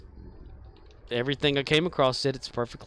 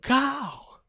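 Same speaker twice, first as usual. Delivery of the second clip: high, loud crow caw; 14 semitones higher, 5 dB louder than usual. Retold or imitated. Man answering crows on the power lines, loud high caw. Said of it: "So I'll go".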